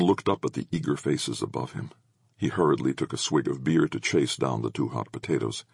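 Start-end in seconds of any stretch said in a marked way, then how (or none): none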